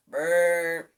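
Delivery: loud buzzing brrr like a truck horn; horn blare. A narrator's reading. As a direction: buzz the lips like a horn. Horn sound in story.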